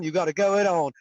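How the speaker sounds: ordinary talk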